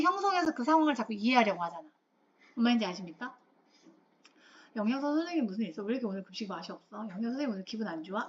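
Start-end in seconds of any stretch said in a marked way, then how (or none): 4.8-8.3 quick, low-volume mock grumble; rapid, hushed speculation in an acted aside; acted student grumbling. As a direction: toss it off fast and under your voice, like gossip among students. Acting out students grumbling about lunch.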